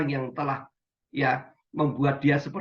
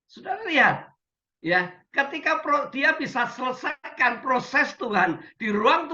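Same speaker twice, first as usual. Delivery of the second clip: much higher, fervent preacher's cry; rising exultant preaching; 9 semitones higher than usual. Real, not acted. Pastor exults to Zoom congregation, high fervent preaching. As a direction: lift the congregation with joyful fervor.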